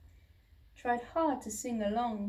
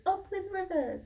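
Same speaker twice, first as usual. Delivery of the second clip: much higher, bright hoot; happy hooting; 9 semitones higher than usual. A narrator's reading. Duckbills hooting happily by the river. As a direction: hoot it with joy. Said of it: "they hooted".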